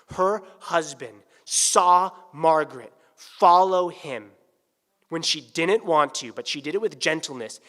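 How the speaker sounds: loud, building sermon voice; emphatic preaching. Acted. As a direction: drive the lesson home. Preaching rises again.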